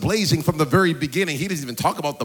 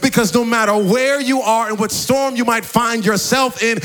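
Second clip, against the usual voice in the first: impassioned preaching; 5 semitones higher, 4 dB louder than usual. Real, not acted. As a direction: preach it with rising fervour, higher and louder towards the end. Preacher builds to full force.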